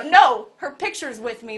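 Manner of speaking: usual speaking voice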